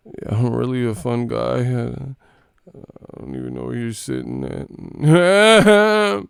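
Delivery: mock dopey drawl, slowed and rising; a hammed-up goofy interview voice; mocking imitation. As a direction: parody a player's odd interview voice. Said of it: "What was that?".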